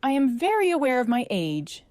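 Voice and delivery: crisp, tart retort, pitch lifted. A narrator's reading. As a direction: answer a touch sharply. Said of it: "said Alba tartly".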